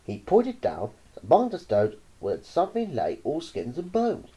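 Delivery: ordinary delivery, nothing marked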